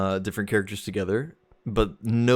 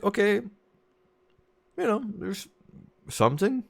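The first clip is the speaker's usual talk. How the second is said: high-pitched, tentatively encouraged; tentative hopeful reaction; 12 semitones higher than usual. Acted. Gamer reacting to hints of in-game romance, high and tentative.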